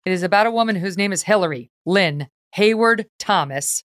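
ordinary delivery

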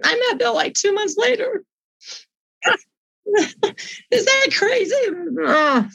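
high, tearful break in the voice; overcome, laughing through tears; choked-up, wondering; emotional. Real, not acted. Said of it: "I get really emotional about it".

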